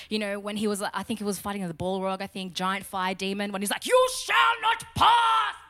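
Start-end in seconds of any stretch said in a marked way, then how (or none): 3.9-5.6 slow, high, full-throated battle shout; Gandalf. Acted. Wizard fighting a giant fire demon, hammed-up shouted defiance. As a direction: bellow each word as a hammed-up defiant command. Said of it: "do what Gandalf did".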